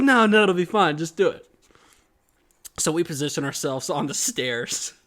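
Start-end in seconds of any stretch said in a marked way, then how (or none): 0.0-1.5 higher, louder dismissal; lightly voiced dad waving off the worry; insistent father. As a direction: push him to go along. Acts out father overriding the doubt.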